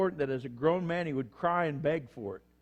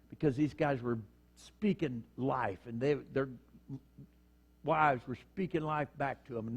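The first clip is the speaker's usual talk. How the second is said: ordinary talk, nothing marked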